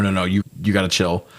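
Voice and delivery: usual speaking voice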